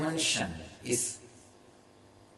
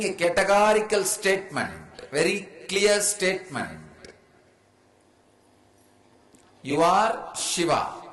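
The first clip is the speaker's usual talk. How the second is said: much higher and louder, fast and insistent; forceful sermon; 8 semitones higher, 5 dB louder than usual. Acted. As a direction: crush every doubt with certainty. Forceful categorical answer.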